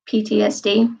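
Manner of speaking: usual speaking voice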